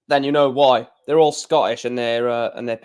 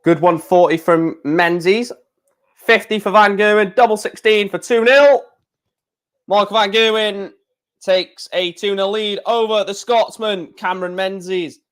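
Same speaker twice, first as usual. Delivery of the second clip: lightly raised commentator read; 6 semitones higher than usual. Acted. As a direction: narrate the throws like the telly. Mock darts commentary.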